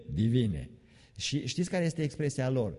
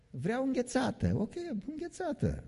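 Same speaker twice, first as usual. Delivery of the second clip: put-on high, soft little child asking; squeaky kid wanting a treat; 14 semitones higher, 4 dB quieter than usual. Child telling parent it wants ice cream, high and soft.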